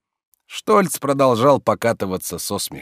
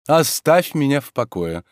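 higher, pompous command; self-important; 5 semitones higher, 3 dB louder than usual. A narrator's reading. Master lying back down dismissing servant, self-important. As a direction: say it with lordly importance. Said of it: "сказал он важно".

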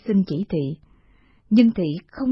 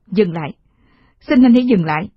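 louder, desperate plea to stop; pleading shout; 7 dB louder than usual. Acted. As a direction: cry out for him to stop. Mother begs him stop.

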